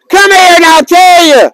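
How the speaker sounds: high holler to gather a crowd; calling out. Real, not acted. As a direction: call them over from across the park.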